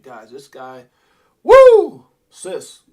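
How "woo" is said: a loud, high "woo"; hype whoop. Real, not acted. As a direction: whoop with excitement. Hyped reaction to the tweet.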